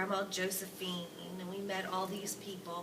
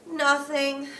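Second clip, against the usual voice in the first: higher, louder dismissive grandpa; quick loud nothing; 7 semitones higher, 12 dB louder than usual. Acted. Grandpa Joe brushing off Grandma Josephine, loudly. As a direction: wave her question away.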